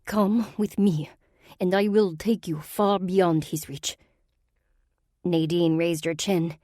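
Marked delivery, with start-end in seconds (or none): 0.0-4.0 put-on slow, scornful Russian-accented sorceress; an aristocratic villain in a Russian accent.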